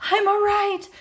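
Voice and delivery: high-pitched, reassuring call. A narrator's reading. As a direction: call across to worried friends. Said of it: "she called".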